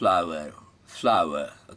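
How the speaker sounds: flower said the American way, with a clear ow and r; American pronunciation of flower. Shown as a demonstration. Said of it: "the Americans pronounce it". (अमेरिकी उच्चारण में flower)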